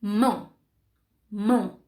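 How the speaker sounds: "Mão" as one isolated, deliberate beat; teacher sounding out "Mão" as one block; one-syllable demonstration. Shown as a demonstration. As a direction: say "Mão" alone in a single mouth-opening. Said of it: "Quantas vezes abri a boca?".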